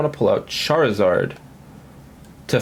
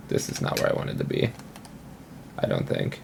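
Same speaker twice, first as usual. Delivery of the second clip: whispered aside to self; muttering under breath; 9 dB quieter, faster than usual. Real, not acted. Muttering to himself over a bad move.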